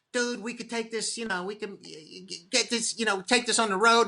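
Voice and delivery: high-pitched eager pitch to bandmates; Bobby Kelly. Retold or imitated. Friend urging the group to tour, high imitated voice. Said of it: "it was Bobby Kelly that was like".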